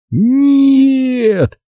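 high long cry while falling; piercing cry. A narrator's reading. Boy slipping off the branch, piercing cry. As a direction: cry out as you lose balance. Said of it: "пронзительный крик".

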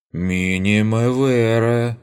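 drawn-out, pouting protest; mock offended. Mock offended Latin remark. (шутливо обиженно)

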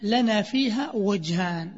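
ordinary talk, nothing marked

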